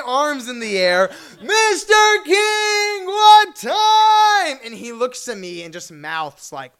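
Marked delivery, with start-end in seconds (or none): 1.4-4.6 high, loud, sung-out shout; frantic shout. Retold or imitated. Fan in front of the car shouting at Larry King, loud sung shout. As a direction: wail it while waving your arms.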